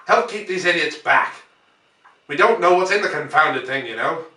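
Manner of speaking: high, loud hail; calling out. A narrator's reading. Ogilvy calls to the narrator, loud and high. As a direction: call out over the noise.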